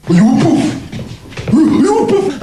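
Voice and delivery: put-on high, slow, horrified old man; frightened grandad. Frightened grandad questioning his grandson, mock horror. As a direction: squeal the question in mock dread. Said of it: "he was frightened. He'd go".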